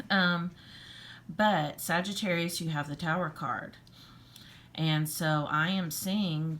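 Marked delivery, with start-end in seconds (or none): none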